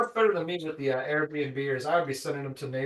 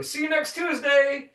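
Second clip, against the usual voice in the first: coworkers' farewell; 9 semitones higher than usual. Retold or imitated. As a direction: chirp it sweetly to a coworker. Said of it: "that's what they say to everybody".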